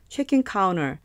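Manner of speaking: check-in counter run together quickly, nearly "chekinkauner". Shown as a demonstration. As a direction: rattle check-in counter off fast and linked. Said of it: "더 빠르게 하면요". (빠르게 이어서 발음하는 check-in counter)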